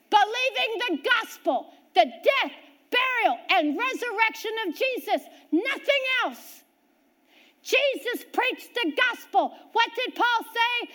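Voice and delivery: rising, ringing pulpit preaching with a shout on resurrection; fervent preaching. Acted. Preacher to congregation, about believing the gospel, fervent preaching. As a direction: drive the gospel home from the pulpit. Preacher builds to full force.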